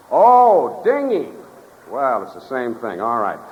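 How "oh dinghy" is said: sudden realization. Acted. Sam realizes it was the dinghy.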